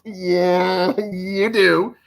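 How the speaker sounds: loud, high, drawn-out yeah; emphatic agreement. Retold or imitated. Broadcaster telling his coach he looked stupid, emphatically. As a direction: agree loudly, as if it's obvious.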